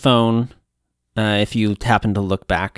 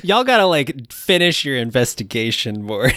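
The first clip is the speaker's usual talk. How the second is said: ordinary delivery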